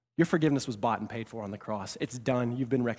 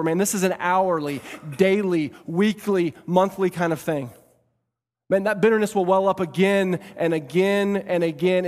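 raised, emphatic sermon delivery; forceful preaching; 6 semitones higher, 7 dB louder than usual. Real, not acted. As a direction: drive each phrase home to the congregation.

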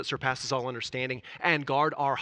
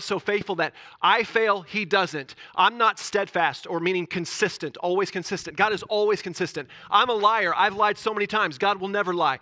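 raised, louder preaching voice building to a peak; fervent preaching; 4 semitones higher, 5 dB louder than usual. Acted. Pastor preaching to the congregation, full force.

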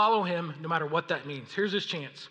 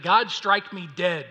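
slow, louder vow; solemn oath; 8 dB louder, slower than usual. Acted. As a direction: swear it slowly, daring heaven. Peter swearing an oath.

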